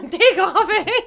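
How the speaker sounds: loud, high mock Canadian, laughing; Bob and Doug McKenzie. Co-host capping the commercial, laughing mock Canadian. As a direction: shout it as a hammed-up Canadian hoser. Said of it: "I need to do a Bob and Doug McKenzie".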